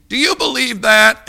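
high, loud challenging question; mock challenge. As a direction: fire it off as someone testing a believer.

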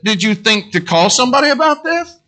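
incredulous. Retold or imitated. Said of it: "I said".

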